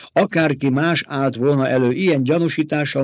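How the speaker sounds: ordinary delivery, nothing marked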